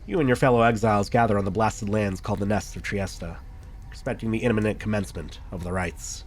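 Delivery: even, measured scene-setting read; story narration. Acted. Reads game narration aloud.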